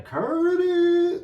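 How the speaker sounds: high, loud drawn-out yell of the name. Retold or imitated. Vlogger imitating [name] calling out [name], loud and high. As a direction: belt the name out like calling across the room. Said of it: "[name] killed me when he said that".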